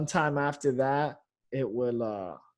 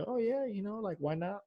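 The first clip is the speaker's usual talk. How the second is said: high, soft tentative musing; musing to himself; 6 semitones higher, 8 dB quieter than usual. Retold or imitated. Comic recalling his thought of trying sportscasting, musing to himself. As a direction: float the idea lightly, high and quiet.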